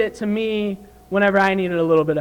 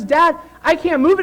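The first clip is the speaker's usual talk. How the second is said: put-on annoyed child; 6 semitones higher than usual. Little girl telling dad it won't move, mock annoyed. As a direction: complain up to dad in mild annoyance. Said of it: "she's annoyed".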